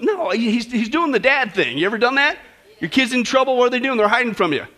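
usual speaking voice

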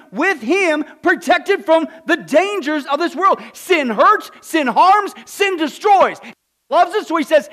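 loud, high fiery sermon; preaching at full force. Acted. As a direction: drive it home to the congregation.

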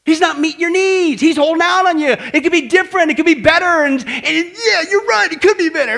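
high, loud, insistent tempter; devil's goading voice. Acted. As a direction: urge them on like a nagging devil. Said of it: "Where do you think that's coming from?".

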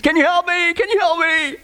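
high-pitched mock plea for help; acted pleading. Tourist begs the heavenly voice for rescue, acted pleading. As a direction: beg in hammed-up desperation, voice way up high. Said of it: "he pleads, he says".

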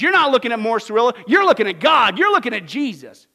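loud, fast boastful quote; mock bold claim. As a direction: fire off the preacher's boast. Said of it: "Morris Cerullo says".

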